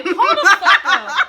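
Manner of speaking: loud, high, drawn-out disbelief; startled outburst. Retold or imitated. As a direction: stop everything, acted out loudly. Acting out startled reaction to news.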